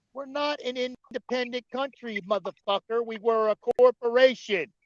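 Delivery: loud, high, angry lecturing; shouting down a caller, exasperated; heated rant. Real, not acted. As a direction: hammer every point home at Don.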